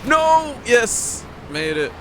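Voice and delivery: high, loud cry flipping from dread to joy; real gamer panic turning to relief; relieved triumph. Real, not acted. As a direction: yell like you barely made the jump. Narrow escape in the game.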